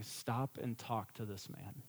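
soft, low, slow inner voice; a hushed inward command; inner prompting. Retold or imitated. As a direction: say it like a quiet nudge in the heart. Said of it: "the conviction of the Spirit of God saying".

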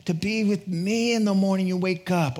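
ordinary delivery

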